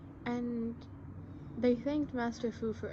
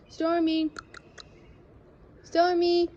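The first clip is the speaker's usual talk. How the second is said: calling out loud; 9 semitones higher, 7 dB louder, slower than usual. Real, not acted. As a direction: call the cat's name high and loud. Calling the cat from balcony.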